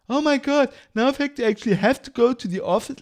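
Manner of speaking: high, fast, whiny mock complaint; mocking whine. Mocking remote workers, a high fast whiny mock complaint. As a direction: parody a spoiled worker moaning about the office.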